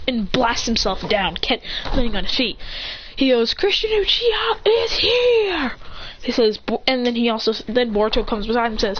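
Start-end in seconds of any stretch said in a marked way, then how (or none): 3.5-5.7 high, drawn-out hero entrance call; heroic announcement. Acted. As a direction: proclaim your arrival, stretching it out. Hero announces his arrival.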